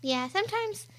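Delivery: ordinary delivery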